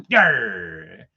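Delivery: low, loud pirate yarr; gruff pirate reply; pirate growl. Retold or imitated. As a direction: grunt like a cartoon pirate. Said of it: "it was Mike the pirate".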